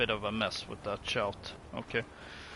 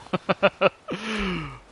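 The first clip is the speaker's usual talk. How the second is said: laughing; 10 semitones higher, 9 dB louder than usual. Real, not acted. Poor fishermen joke.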